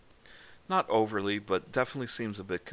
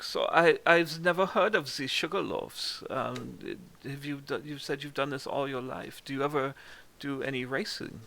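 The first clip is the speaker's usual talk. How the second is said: put-on curious German-accented questions, pitched up a little; 5 semitones higher than usual. Game master as Reese questioning a stranger, German accent. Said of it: "The German one?".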